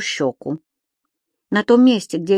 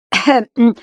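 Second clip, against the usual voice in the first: pointed cough; 5 semitones higher, 6 dB louder than usual. Acted. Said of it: "с некоторым упрёком в голосе".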